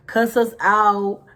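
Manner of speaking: normal talking voice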